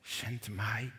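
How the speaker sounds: breathless whisper of surrender; whispered prayer. Acted. Preacher whispering the listeners' answer to God. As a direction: whisper it as a final offering.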